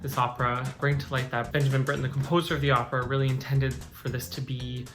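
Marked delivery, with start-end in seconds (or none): none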